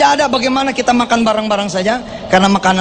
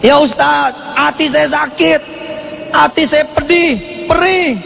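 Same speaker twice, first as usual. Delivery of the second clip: higher, slow plea of a hurt mother; complaining woman; 7 semitones higher than usual. Retold or imitated. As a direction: voice a wounded woman begging the ustaz. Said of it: "seorang ibu".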